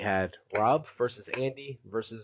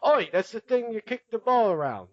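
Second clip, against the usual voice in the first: jokey put-on English accent, higher and louder; 9 semitones higher, 5 dB louder than usual. Podcast host mocking London football fans, in a hammed-up English accent. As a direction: ham up a cheeky English bloke. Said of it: "that's my English accent".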